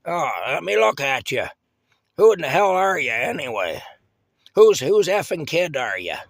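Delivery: put-on loud, high squinting old woman grilling a kid; cranky old lady. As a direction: bark at the kid like a gruff old neighbour. Aunt Sarah voiced in story.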